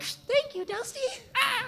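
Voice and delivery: squeaky high teary thank you; tearful falsetto thanks. Retold or imitated. Imagined fan thanking Dusty in a teary squeak. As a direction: squeak it out like you're about to cry. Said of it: "it'll just be like".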